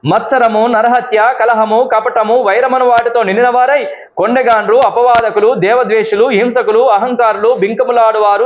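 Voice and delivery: scripture reading. Acted. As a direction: intone the list of sins one by one.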